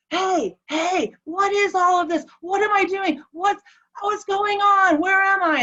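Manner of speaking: high-pitched retold panic, not full scream; mock screaming panic. Husband waking confused in intensive care, imitated panicked cries. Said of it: "he wakes up screaming".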